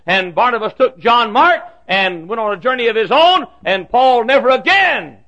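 high, loud pulpit shout; full-force preaching. Real, not acted. Preacher telling of Paul and Barnabas's split, full-force preaching. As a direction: thunder the story out to the back pews.

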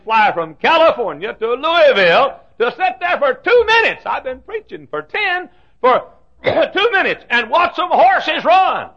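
slow, loud, rising disbelief; incredulous challenge. Retold or imitated. Preacher challenging the horse fan on the plane, loud and incredulous. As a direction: press him, baffled he'd fly so far. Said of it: "I said".

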